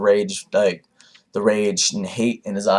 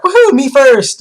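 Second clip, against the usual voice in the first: mock cheer, much higher and louder; excited; 10 dB louder, slower than usual. B whooping to jump into the fight, high and loud. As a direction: whoop it like a kid who can't wait. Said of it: "B gets excited too".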